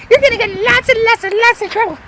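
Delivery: put-on high-pitched little-boy threat; tattling kid. Hurt cousin threatens trouble, high tattling kid voice.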